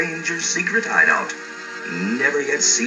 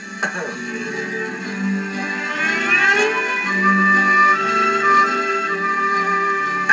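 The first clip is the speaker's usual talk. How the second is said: long ringing laugh, much higher; hearty hero laugh. Acted. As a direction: laugh big and bold like a cowboy hero. Hero's signature laugh after joke.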